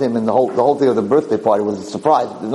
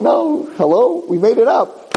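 incredulous retort; 11 semitones higher, slower than usual. Retold or imitated. Mocking reply to the duped student.

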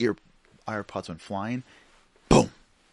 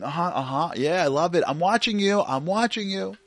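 loud, higher, singsong half-listening dad; mock attentive dad, pitched up; distracted dad; 10 semitones higher, 9 dB louder than usual. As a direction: toss out automatic encouragement while busy. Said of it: "he's doing it the whole time".

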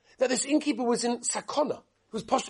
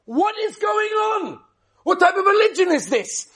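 mock outraged shout; 8 semitones higher, 5 dB louder than usual.

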